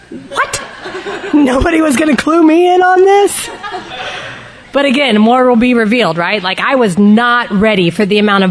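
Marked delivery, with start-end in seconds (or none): 0.1-3.5 high, incredulous mock protest; mock disbelief. Speaker jokes to the room about being left out, mock disbelief. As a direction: play up being the last to know. Acts out own comic disbelief.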